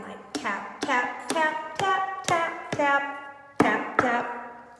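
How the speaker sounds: tap, tap said in a light even beat; tapping rhythm. Shown as a demonstration. Teacher showing tapping to children, rhythmic chant. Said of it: "What is tapping?".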